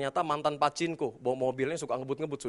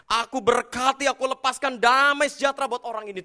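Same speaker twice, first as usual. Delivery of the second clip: loud, high earnest blessing; fervent prayer; 9 semitones higher, 8 dB louder than usual. Retold or imitated. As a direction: cry out a blessing to God. Praying a blessing aloud. (doa berkat yang lantang)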